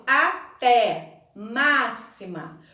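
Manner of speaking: "até, máxima" slowly, wide open É and Á; open vowels. Shown as a demonstration. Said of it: "pronunciadas com a boca bem aberta".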